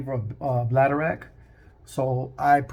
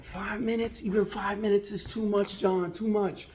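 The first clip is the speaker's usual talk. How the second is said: incredulous complaint; 7 semitones higher than usual. Retold or imitated. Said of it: "So the guy's like".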